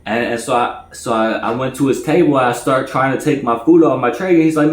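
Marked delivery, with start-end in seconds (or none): none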